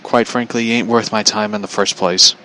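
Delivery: ordinary talk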